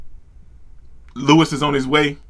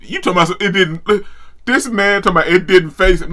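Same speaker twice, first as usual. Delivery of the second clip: usual speaking voice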